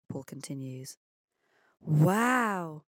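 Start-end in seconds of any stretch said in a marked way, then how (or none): 1.8-2.9 long, louder wow of wonder; blown away. A narrator's reading. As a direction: let the word swell in amazement. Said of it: "Pablo blows".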